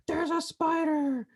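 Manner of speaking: high, drawn-out mock shriek; stretched, high-pitched pretend panic; imitated scream. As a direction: imitate a friend's spider scream without real volume. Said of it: "she screams".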